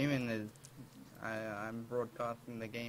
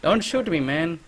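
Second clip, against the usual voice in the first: shouting; 6 semitones higher, 17 dB louder than usual. Real, not acted. Panicked plea after being recognized.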